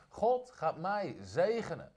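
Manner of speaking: slow, deliberate proclamation; slow, weighty confession of faith; slow declaration. Shown as a demonstration. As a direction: spell it out slowly for the church to repeat. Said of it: "Zeg het eens hardop".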